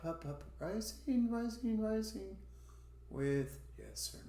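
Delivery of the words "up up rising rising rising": teacher's climbing demonstration of "rising"; rising intonation. Shown as a demonstration. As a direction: step the pitch up on "up, up, rising". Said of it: "You, you go up".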